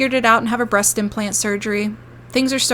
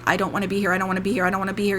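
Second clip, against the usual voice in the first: ordinary talk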